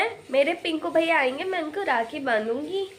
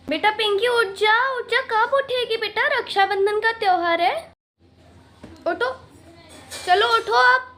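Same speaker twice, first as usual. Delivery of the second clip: calling to wake up; 7 semitones higher, 4 dB louder than usual. Acted. Mother waking daughter on festival.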